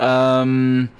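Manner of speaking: ordinary talk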